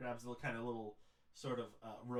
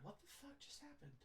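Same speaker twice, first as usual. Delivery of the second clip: inner voice; 14 dB quieter than usual. Acted. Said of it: "his brain's like".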